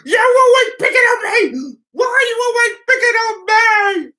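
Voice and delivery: high, loud mock wail; whiny wailed complaint. Retelling Dylan's outburst to viewers, mock wailing. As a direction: imitate a loud, high, put-upon cry. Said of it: "then went".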